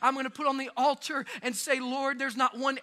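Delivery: rising impassioned prayer, building; fervent preaching prayer. Acted. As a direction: cry it out to God, building.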